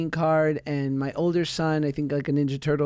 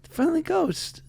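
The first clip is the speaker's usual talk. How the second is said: bright high sing-song explanation; chirpy explanation; 11 semitones higher than usual. Retold or imitated. Mother explaining Casper to her son, high sing-song. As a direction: answer the boy brightly, pitched way up. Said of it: "And I'm like".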